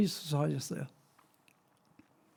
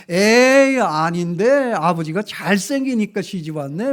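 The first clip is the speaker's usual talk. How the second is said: louder, playful needling; teasing reply; 4 semitones higher, 11 dB louder, slower than usual. Retold or imitated. Son teasing his mother before running off, loud cheeky taunt. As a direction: poke at her with a cheeky grin.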